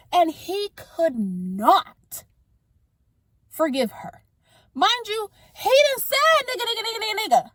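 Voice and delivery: high, loud heated rant; letting the anger spill out, rising high and loud; getting heated. Real, not acted. Vlogger ranting to viewers, rising high and loud in heated anger. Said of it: "I'm getting heated".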